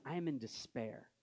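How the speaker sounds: soft, low imagined admission of despair; subdued, lightly acted despair. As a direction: quietly voice someone who has given up. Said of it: "you rarely meet someone that says".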